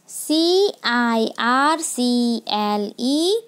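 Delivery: each letter spaced out slowly; slow dictation of letters; slow spelling. Shown as a demonstration. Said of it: "teacher spells word for class".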